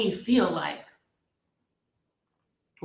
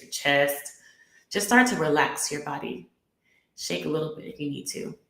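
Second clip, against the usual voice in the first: guided meditation. Acted. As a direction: guide them gently into the heart.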